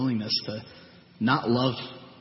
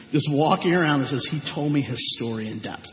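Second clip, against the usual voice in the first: ordinary talk, nothing marked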